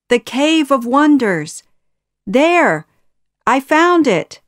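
high, loud triumphant announcement; excited discovery. A narrator's reading. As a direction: call out the find, thrilled and loud. Short man announces his find.

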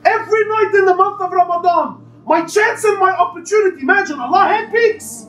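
high, urgent sermon voice with rising passion; impassioned preaching. Acted. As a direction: preach it with full force to the congregation. Continues preaching Ramadan mercy.

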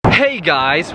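ordinary talk

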